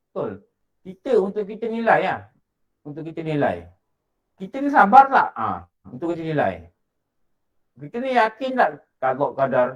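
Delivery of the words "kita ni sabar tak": sharp challenge. Real, not acted. Preacher challenges listeners' patience.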